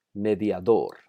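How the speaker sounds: mediador with its final syllable "dor" stressed; stress on last syllable. Shown as a demonstration. Teacher showing students the accent in mediador, last syllable stressed. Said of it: "Note el acento en la última sílaba".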